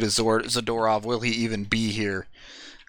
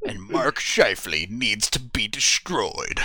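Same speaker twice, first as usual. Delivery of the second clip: slow, dramatic trailer voice-over; mock-epic film trailer announcer; movie trailer narrator; slower than usual. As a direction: draw it out with trailer doom. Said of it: "Sounds like a movie trailer".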